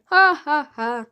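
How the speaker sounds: loud spelled-out ha ha ha; mock laugh. A narrator's reading. Mister Big laughing at the boy, loud hammed-up laugh. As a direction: ham up a boss's laugh, loud. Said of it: "Mister Big started laughing".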